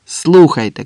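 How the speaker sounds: acted cry across to others; shouted call. A narrator's reading. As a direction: call out sharply to be heard. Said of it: "крикнув Андрес".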